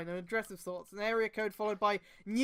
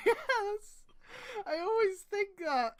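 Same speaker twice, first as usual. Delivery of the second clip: laughing yes; 10 semitones higher, slower than usual. Real, not acted. Laughing agreement with friend.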